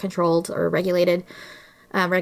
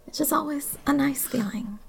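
whispered aside; 5 semitones higher, 6 dB quieter than usual. Real, not acted. Whispered aside about therapy.

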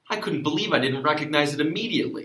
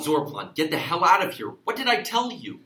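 angry; 5 semitones higher, 5 dB louder than usual. Acted. Sheriff scolding deputy.

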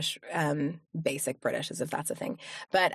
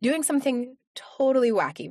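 ordinary talk

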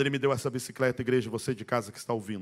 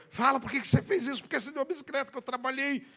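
put-on angry older brother; 13 semitones higher than usual. Older brother scolds the boy for giving away the bicycle, high angry shout. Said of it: "E ele me batia e dizia".

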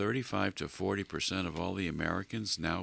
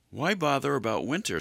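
higher, louder carefree grasshopper; carefree chirpy grasshopper; 7 semitones higher, 5 dB louder than usual. Acted. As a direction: toss it off lightly as the grasshopper. Grasshopper voiced in fable.

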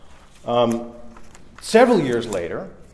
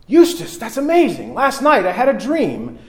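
higher, eager wife's voice; lightly voiced wife, pitched up; excited wife; 9 semitones higher, 4 dB louder than usual.

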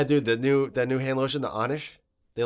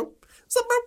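high squeaky voice; slower than usual. Acted. High squeaky greeting to Griffin.

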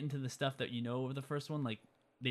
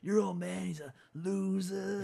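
mock bully taunt; 6 semitones higher, 3 dB louder, slower than usual. Biff taunting the McFlys.

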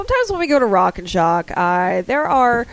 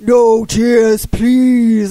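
louder, measured Pinhead line; a light Pinhead impression, cold and commanding; lightly voiced horror villain; 7 dB louder, much slower than usual. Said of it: "he's like, you know".

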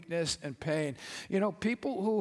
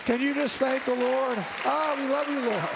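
praise break worship; 5 semitones higher than usual. Real, not acted. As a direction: pour out praise at full force.

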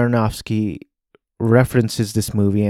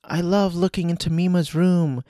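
much higher, sweet but eerie fan voice; creepy fan; 8 semitones higher than usual. Retold or imitated. Stalker fan calling to Mima in the fans' cheerful tone. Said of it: "in that same tone of voice".

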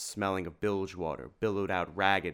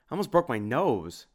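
higher, aggrieved whine; pained complaint; 6 semitones higher, 4 dB louder than usual. A narrator's reading. Tim complains after running into the wall, pained complaint.

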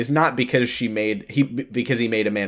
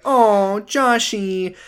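high, sweet mock-tender "Oh, Joshy"; mock swooning girlfriend; 9 semitones higher, much slower than usual. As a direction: coo it like a doting partner. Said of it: "I'm supposed to be like".

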